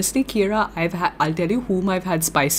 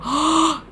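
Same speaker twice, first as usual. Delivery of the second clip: high, drawn-in gasp; gasp of realization; 11 semitones higher than usual. Real, not acted. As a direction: gasp as it dawns on you. Sudden realization about herself.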